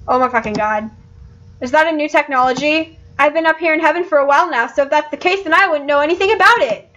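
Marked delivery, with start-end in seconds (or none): 1.6-6.9 high-pitched, chatty character voice; lightly innocent and perky, hurrying along; cheerful heaven dog. Acted. Heaven's dog explaining ignorance of Wi-Fi, high and perky.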